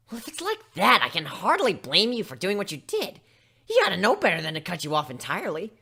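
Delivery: brash pony taking her side; emphatic pony voice. Acted. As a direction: side with her friend firmly. Dash reacts, sympathetic.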